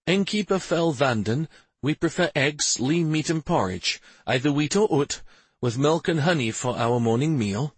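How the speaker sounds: a hint of formal royal courtesy; royal guest. A narrator's reading.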